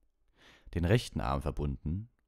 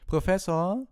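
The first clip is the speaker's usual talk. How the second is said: high, loud, stretched-out call; drawled; 8 dB louder, slower than usual. A narrator's reading. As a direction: draw the word out like a tattling pupil. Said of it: "sagte Malfoy gedehnt".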